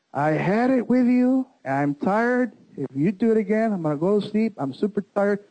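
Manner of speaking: imitating an exasperated wife, pitched high; fed-up wife. Wife refuses to hear it again, imitated high-pitched exasperation. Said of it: "this is her face again".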